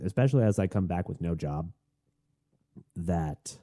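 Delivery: ordinary delivery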